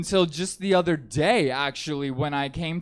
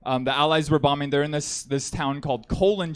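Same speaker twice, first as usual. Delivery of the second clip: ordinary delivery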